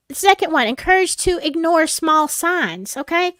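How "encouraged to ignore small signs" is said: ordinary talk, nothing marked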